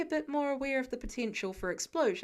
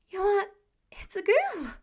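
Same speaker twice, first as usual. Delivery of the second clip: high, loud excited gasp; gasping realization; 8 semitones higher, 7 dB louder, slower than usual. Acted. Peter realizing the baby's a girl, loud and high. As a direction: burst out with sudden discovery. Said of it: "He gasps".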